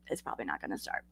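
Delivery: very low, hushed aside; quiet retold inner thought; muttered thought. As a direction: mutter it under your breath. Retold private thought in the car.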